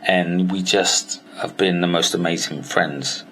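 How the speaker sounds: ordinary delivery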